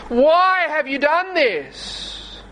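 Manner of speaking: high, very slow, aching plea; pleading. Shown as a demonstration. The Lord asking His wayward people, pleading. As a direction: plead with them as a grieving father would. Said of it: "he said this pleadingly".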